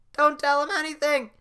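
very high, loud yelling; squeaky shout. Acted. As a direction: scream a warning. Gingerbread man shouting.

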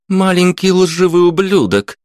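high-pitched furious insult; angry outburst. A narrator's reading. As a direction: spit the insult out in fury. Woman lunges at the lad.

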